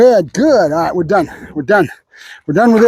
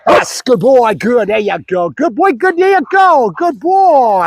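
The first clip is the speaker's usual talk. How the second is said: high, bouncy praise building higher; excited praise; 5 semitones higher, slower than usual. Real, not acted. Trainer rewards Bruno's bark, highly excited praise. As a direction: cheer the dog on as it succeeds.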